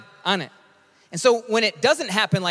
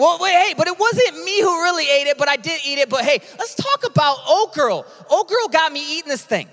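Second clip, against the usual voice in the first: high, loud, hammed-up whiny excuse-making; defensive Adam paraphrase; 8 semitones higher, 3 dB louder than usual. Pastor voicing Adam blaming Eve, high and whiny. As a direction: play Adam passing the blame like a caught kid. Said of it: "this is my paraphrase".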